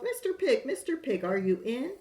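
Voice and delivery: high sing-song call to the door; wolf calls to the pig at his door, sing-song. Acted. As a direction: call sweetly through the door. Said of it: "I called".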